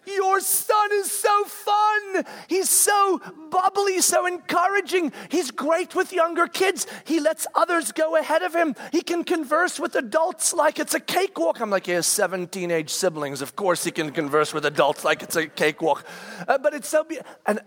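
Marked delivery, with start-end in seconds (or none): 0.1-11.6 imitating adults raving about his boy; excited raving. People raving about youngest son. 11.9-16.0 lower, matter-of-fact comeback; deadpan it flat and low; dad answering the praise dryly; dry retort. Real, not acted.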